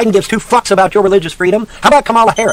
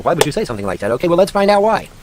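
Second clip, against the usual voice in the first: normal talking voice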